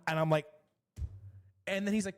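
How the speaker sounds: ordinary delivery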